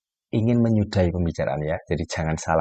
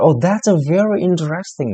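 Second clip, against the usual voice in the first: high, louder, lively "very interesting"; genuinely impressed; 9 semitones higher, 5 dB louder than usual. Shown as a demonstration. Teacher showing real praise with lively intonation. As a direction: sound truly delighted by the talk. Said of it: "intonasi, ... ekspresi wajah yang bagus".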